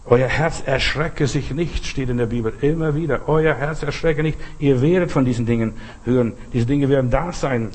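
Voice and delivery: ordinary talk, nothing marked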